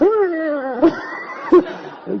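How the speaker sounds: a high real laugh; burst of laughter. Real, not acted. Recalling the boyfriend sitting down, high laughing burst. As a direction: let a big laugh out. Laughs after the man storms off.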